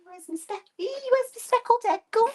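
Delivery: high-pitched fussing over something lost; playful, very high-pitched fretting; mock panic. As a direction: squeal it in pretend alarm. Mock fuss over lost tool.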